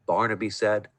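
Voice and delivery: usual speaking voice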